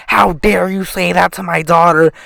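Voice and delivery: a lightly voiced scolding mother; mock outrage, only a hint of a different voice; imitating an angry mom. Angry mother scolding the boy in the principal's office, a lightly voiced mock tirade. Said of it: "bitching up a storm at me".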